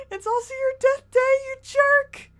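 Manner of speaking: yelling at the dying character; upset yell. Real, not acted. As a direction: shout it at him half-sobbing.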